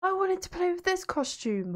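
high, startled cry of disappointment; surprised dismay. Real, not acted. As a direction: yelp then whine about the costume. Costume unlock goes wrong.

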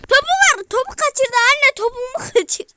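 loud, high yell; exasperated shout. Real, not acted. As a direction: yell at the screen. Topu kaçırınca bağırış. (ekrana bağıran çıkış)

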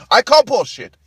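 high, very loud call-out; mock heckling shout. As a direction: shout it out between laughs. Mock heckling between his own laughs.

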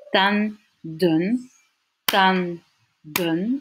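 "tan, dun" with a clear pause between the two syllables; split into syllables. Shown as a demonstration. Teacher cutting the Dutch word for teeth, syllable by syllable. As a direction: break "tan" away from "dun". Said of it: "je vais couper ma syllabe entre le N et le D".

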